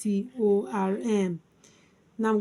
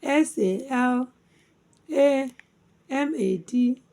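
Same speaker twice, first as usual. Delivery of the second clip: letter groups with pauses, settling at the end; spelled by syllables. Shown as a demonstration. Teacher spelling salamander for learners, syllable by syllable. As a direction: spell in separated chunks, punching the lone A. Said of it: "Salamander divided into four syllables".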